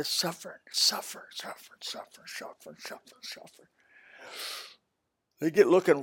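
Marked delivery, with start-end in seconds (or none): 0.0-3.7 whispered fading repeat. Acted. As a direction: whisper the word over and over, fading to nothing. Repeating suffer, dying away.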